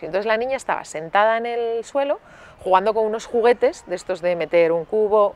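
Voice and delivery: ordinary talk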